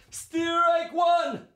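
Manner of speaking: high, loud umpire shout; umpire's call. Acted. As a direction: bellow the call from behind the plate. Umpire calls the strike.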